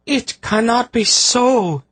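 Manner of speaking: high, slow anguished wail; despairing seer's wail. A narrator's reading. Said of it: "Tsaradis wailed".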